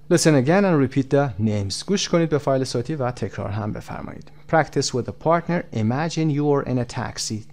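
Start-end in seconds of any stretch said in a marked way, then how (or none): none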